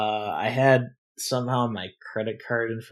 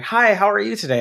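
much higher sing-song greeting; chirpy bank lady; 10 semitones higher, 4 dB louder, faster than usual. Retold or imitated. Bank lady greeting customer, higher chirpy voice. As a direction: play the overly cheerful clerk.

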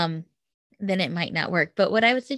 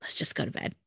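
whisper; 9 dB quieter, faster than usual. Retold or imitated. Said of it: "And we're like".

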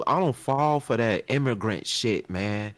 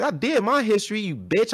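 higher and a bit louder, mock-furious; retold heated comeback; 11 semitones higher, 3 dB louder than usual. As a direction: fire it back at him, higher. Retold heated argument, calling names.

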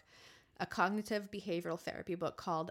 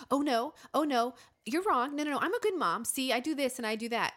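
higher, louder protesting inner voice; false comfort voice; 5 semitones higher, 5 dB louder than usual. Acted. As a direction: push back in hurried self-defense, pitched up. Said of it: "the voice that responds and says".

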